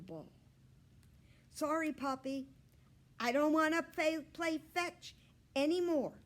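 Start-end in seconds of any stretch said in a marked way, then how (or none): 1.5-6.2 high, louder squeaking; apologetic squeak. A narrator's reading. Hamster telling the puppy no more fetch. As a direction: squeak a sorry to the dog. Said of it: "I squeaked".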